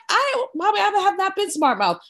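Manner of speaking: high indignant protest to mom. Retold or imitated. Daughter protesting to her mother, pitched high and indignant. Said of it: "I'm like".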